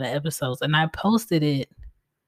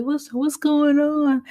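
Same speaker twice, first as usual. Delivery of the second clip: imitating kids; 11 semitones higher, 3 dB louder, slower than usual. Kids asking what's wrong.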